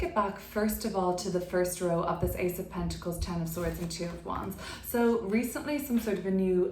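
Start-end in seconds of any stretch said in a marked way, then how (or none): none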